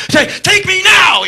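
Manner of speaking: very high, loud fan shriek; mock swooning scream. Women in the crowd swooning over the song, high shriek. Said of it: "Scandinavian women just like".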